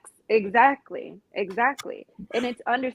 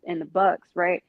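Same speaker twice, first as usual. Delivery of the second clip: ordinary talk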